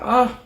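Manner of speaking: loud high "ah" of frustration; exasperated yelp; annoyed outcry; frustrated cry. Real, not acted. Frustration at missing bacon.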